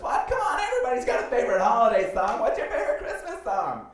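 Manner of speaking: high, loud mock-perky squeal; overly positive date. Perky date presses him for an answer, mocked overexcited squeal. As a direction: pester with hammed-up, relentless cheer. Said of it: "She was, like, overly positive".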